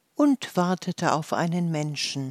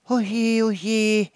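high, louder mock lament; worried gnome wails, higher and louder; wailing; 5 semitones higher, 5 dB louder than usual. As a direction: wail oje like a fretful gnome. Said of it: "jammerte eines der Waldmännlein".

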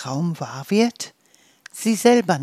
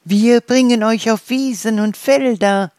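chirping birds; 5 semitones higher, 7 dB louder than usual. Acted. Birds chirping their approval to the dandelions, high and loud. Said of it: "zwitscherten die Vögel".

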